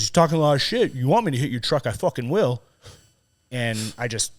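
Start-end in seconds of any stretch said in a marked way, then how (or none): 0.0-2.6 loud, raised-pitch belligerent quote; retold threat. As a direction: act out his taunting challenge. Retold threat from other man.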